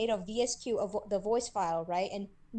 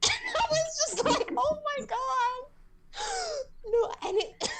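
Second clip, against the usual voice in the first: laughing, pitched way up. Real, not acted. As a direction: squeal through laughter in disbelief. Laughing reaction to meowing video.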